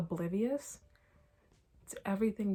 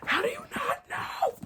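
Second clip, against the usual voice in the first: much higher, slow, near-crying wail; frustrated whine; 4 dB louder, slower than usual. Real, not acted. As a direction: wail it like you're about to cry from frustration. Repeated, escalating into wail.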